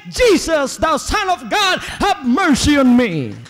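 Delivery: loud, high cry for mercy; desperate beggar's shout. Acted. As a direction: cry out to Jesus at full voice. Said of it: "shout at the top of my voice".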